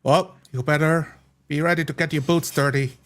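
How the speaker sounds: ordinary talk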